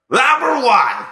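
high, booming announcement in an ex-ballplayer persona; hammed-up, high-pitched list announcement; Buck Martinez. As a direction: belt it out as a comic old-ballplayer character. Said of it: "here to present these is Buck Martinez".

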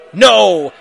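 loud, booming refusal; hammed-up cruel "No"; cruel villain. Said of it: "he laughed crudely".

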